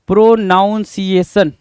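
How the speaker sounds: प्रोनाउंसिएशन slowly with "noun" in the middle; common mispronunciation. Shown as a demonstration. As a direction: sound out "pro-NOUN-ciation" for प्रोनाउंसिएशन. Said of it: "N O यू N बोल दिया भाई".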